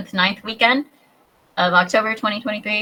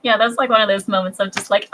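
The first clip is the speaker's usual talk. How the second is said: usual speaking voice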